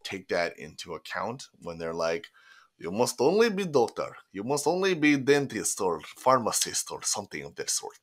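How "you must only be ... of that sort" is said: put-on strict parent, Russian accent; high, insistent Russian accent. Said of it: "I love how you go with a Russian accent for that".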